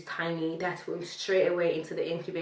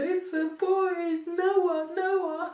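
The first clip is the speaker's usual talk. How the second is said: much higher, slow, emotional calling of the name; mother telling her husband their son is Noah; tearful joy; 12 semitones higher, 3 dB louder, much slower than usual. Retold or imitated. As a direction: savour the name with happy tears.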